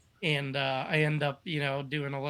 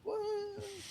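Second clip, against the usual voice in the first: amazed whoa; 7 dB quieter than usual. Retold or imitated. Teammate reacting mid-tandem.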